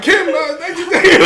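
high, loud laughing babble; flustered comic thanks through laughter; mock nervous. Recruit next to a raging sergeant, mock nervous.

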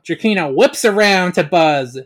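ordinary talk

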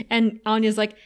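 usual speaking voice

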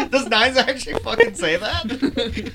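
high laughing disbelief. Real, not acted. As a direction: ask it through laughter.

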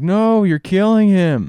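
put-on high, slow pleading child; crying kid voice. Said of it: "Elliot's like".

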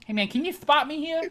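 high lisping bro asking for a spot; lisping gym bro. Retold or imitated. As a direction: lisp "spot" as "thpot". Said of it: "getting spotted".

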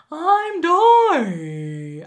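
loud, drawn-out whale-like call, pitched high; Dory impression. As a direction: botch a Dory voice into a long whale wail. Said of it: "try to do a Dory impression, I just sound like the whale from Nemo".

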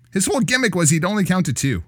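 normal talking voice

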